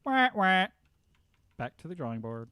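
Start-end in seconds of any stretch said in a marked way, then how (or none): 0.0-0.7 loud, high "wah-wah" fail noise; mock failure sound. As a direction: imitate a sad trombone, loud and high. Mock fail sound after bad mutation.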